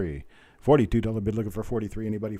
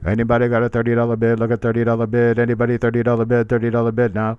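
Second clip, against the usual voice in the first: loud, booming auction chant; auctioneer call; 8 dB louder than usual. Shown as a demonstration. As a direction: belt it out like a cattle auctioneer. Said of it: "that's how you do those".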